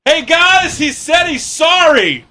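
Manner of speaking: imitating a cashier shouting the apology to the kitchen; mock shout to the kitchen. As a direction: yell it back to the crew as a parody. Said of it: "the girl literally goes".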